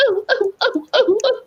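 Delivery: high falsetto whimpers "ooh, ooh"; mock whimpering. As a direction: imitate a beaten man's high, squeaky moans. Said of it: "That's what he sounded like".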